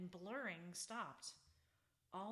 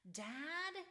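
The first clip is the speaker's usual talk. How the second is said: high, louder panicked cry for Dad; panicked call; 9 semitones higher, 4 dB louder than usual. A narrator's reading. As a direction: call out in rising panic, much higher. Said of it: "He was starting to panic".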